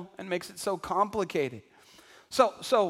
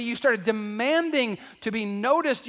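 normal talking voice

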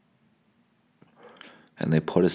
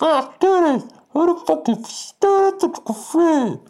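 very high, distorted megaphone rant; girl on megaphone; 4 dB louder than usual. Retold or imitated. Protest leader rallying the crowd over a megaphone, megaphone squawk. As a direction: mimic an angry protester blaring through a megaphone. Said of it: "this girl on the microphone".

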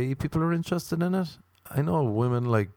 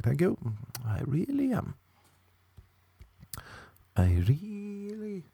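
mock proud thanks; 9 semitones higher, 5 dB quieter, slower than usual. Proud reply to insult.

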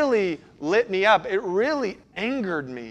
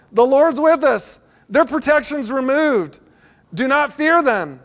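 higher and louder, urgent conviction; fervent plea; 7 semitones higher, 5 dB louder than usual. Acted. Joshua imploring the people not to fear, fervent. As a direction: plead with the crowd to trust.

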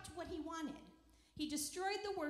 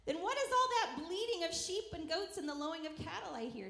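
loud, raised demanding question; 5 semitones higher, 7 dB louder than usual. A narrator's reading. Samuel confronting Saul over the bleating, demanding. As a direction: demand it, starting high and loud. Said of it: "Samuel demanded".

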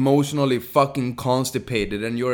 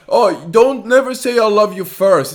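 high, loud mocking voice; mocking dating advice; 8 semitones higher, 6 dB louder than usual. Mocking dating gurus' advice in a loud, high voice. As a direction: parody the advice, higher and louder.

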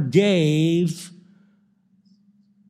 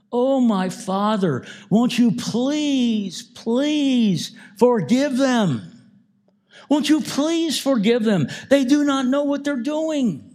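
pleading; 8 semitones higher than usual. Acted. Jesus on the cross begging his Father to forgive them, high pleading voice. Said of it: "he is pleading with his father".

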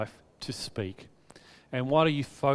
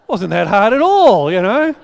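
loud laughing aside, slower; 5 semitones higher, 13 dB louder than usual. Real, not acted. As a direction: chuckle at how easy it was. Laughing at himself.

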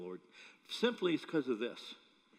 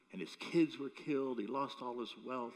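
usual speaking voice

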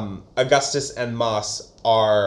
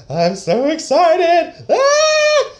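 much higher, louder, slow gleeful squeal; giddy excitement; 6 dB louder, much slower than usual. Retold or imitated. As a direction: squeal with over-the-top delight. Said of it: "Cook's like".

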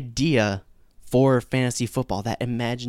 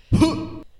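breathy laugh; 4 dB louder than usual. Real, not acted. Laugh after own joke.

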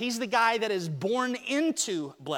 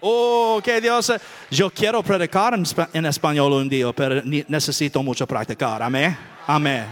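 louder, excited Spanish to the volunteer; 7 semitones lower, 6 dB louder than usual. Real, not acted. Preacher answering volunteer in loud Spanish. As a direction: beam it out in Spanish to the crowd.

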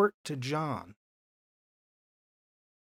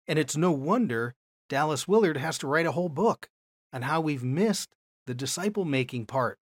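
ordinary talk, nothing marked

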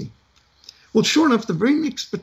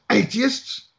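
scowling sergeant major; 9 semitones higher, slower than usual. Retold or imitated. Sergeant major scolding the atheist recruits: mock outraged rant. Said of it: "The Sergeant Major scowled and said".

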